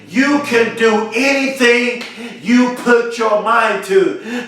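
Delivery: slow, deliberate, every word spaced out; calm down and lay each word down slowly. Shown as a demonstration. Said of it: "Let me say it slowly".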